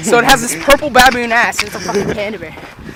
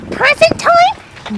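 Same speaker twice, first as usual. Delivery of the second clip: put-on very high, drawn-out little-boy voice; eager kid; much slower than usual. Cousin upstairs hears of presents, high eager squeak. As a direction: squeak it slowly like an excited kid.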